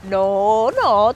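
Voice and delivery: normal talking voice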